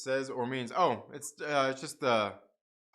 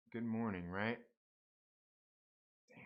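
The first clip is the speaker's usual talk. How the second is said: usual speaking voice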